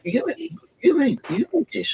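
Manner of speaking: hammed-up high-pitched supervisor; imitating the supervisor. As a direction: put on a high, fussy shop supervisor. Supervisor voiced in retold story.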